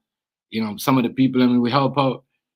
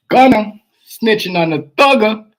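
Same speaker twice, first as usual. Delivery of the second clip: loud, high, drawn-out headline; mock announcement; 8 semitones higher, 7 dB louder, slower than usual. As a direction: announce it big and slow.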